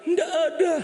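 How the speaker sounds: high, loud begging voice; high pleading woman. Acted. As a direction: plead up high like a desperate wife. Wife answering doctor. (istri memohon ke dokter dengan suara tinggi)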